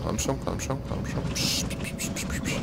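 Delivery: soft, tense urging fading into unvoiced shushing; hushed urging. Real, not acted. Gamer sneaking past enemy, urging and shushing himself softly. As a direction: hush yourself while sneaking.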